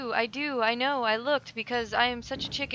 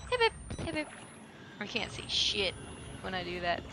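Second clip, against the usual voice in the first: ordinary talk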